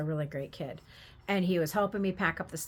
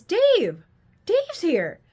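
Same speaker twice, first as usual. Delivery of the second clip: excited calling out; 11 semitones higher, 10 dB louder, much slower than usual. Retold or imitated. Grandson calls out excitedly.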